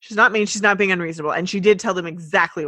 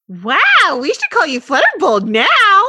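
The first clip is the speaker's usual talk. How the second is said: high, loud amazed voice; impressed character; 8 semitones higher, 9 dB louder than usual. Acted. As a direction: gush at her new boldness. Voicing an impressed friend.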